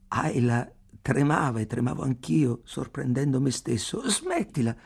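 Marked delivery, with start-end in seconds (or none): 4.1-4.7 high, abrupt interjection; sharp, unexpected objection without laughter; sudden protest. A narrator's reading. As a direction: break in suddenly, pitched up. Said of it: "disse lei all'improvviso".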